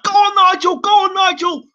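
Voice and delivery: high, loud ringside cheer; high excited shouts of go on; cheering him on. Retold or imitated. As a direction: yell him on like a fan at ringside. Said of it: "I'm like".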